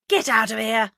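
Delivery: loud, venomous hiss. A narrator's reading. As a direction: order them out through gritted teeth. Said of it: "hissed Mister Parr".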